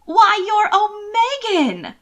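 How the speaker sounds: high, loud, slow cry of dim-witted discovery; crying out in realization. A narrator's reading. Miss Green realizing aloud to Will, high surprised cry. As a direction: blurt it out, suddenly getting it. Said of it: "Miss Green cried out with utter, simple-minded realization".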